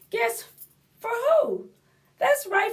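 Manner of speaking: ordinary delivery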